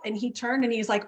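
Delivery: ordinary delivery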